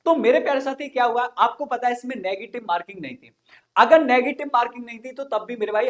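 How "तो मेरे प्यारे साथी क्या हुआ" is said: ordinary talk, nothing marked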